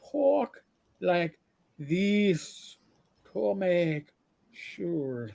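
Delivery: very slow, one word at a time; talking-down slowness. Retold or imitated. Father making sure driver understands, in drawn-out slow speech. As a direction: imitate painstakingly slow speech with long gaps. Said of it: "that's how he did it".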